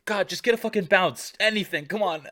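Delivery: high, loud fussy director; hammed-up demanding diva; high mock diva. Acting out film set bit.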